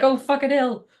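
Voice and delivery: high, loudish mock disbelief; imagined startled viewer. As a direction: play a viewer suddenly baffled and appalled. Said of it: "and it's like".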